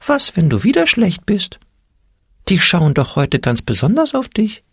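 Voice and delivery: a tiny scared creature piping up; squeaky fearful voice. A narrator's reading. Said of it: "fiepste der Angstpeter".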